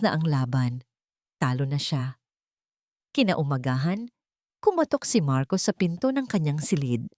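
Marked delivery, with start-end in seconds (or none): none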